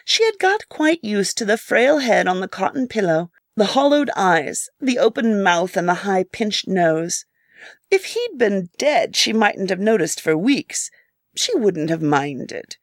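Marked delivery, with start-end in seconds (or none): none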